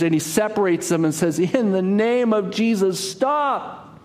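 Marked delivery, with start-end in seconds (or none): 1.4-4.0 crying out. Acted. Monk separating the gladiators.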